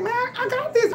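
high, thrilled exclamation; imitating her own breakthrough; excited realization. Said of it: "I'm like".

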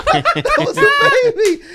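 high, loud, slow and laughing; laughing punchline. Real, not acted. Comedian laughing as he delivers the dancer's realization to the host. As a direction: stretch it out through laughter.

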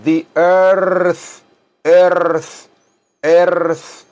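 "earth" with a long Spanish-style R; held R, then TH in "earth". Shown as a demonstration. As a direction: draw out the R in "earth". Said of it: "la R así como en español".

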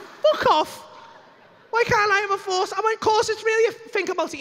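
high, loud wounded disbelief; hammed-up outraged insistence; indignant protest. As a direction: protest at the top of your pitch. Indignantly insists the offer is real.

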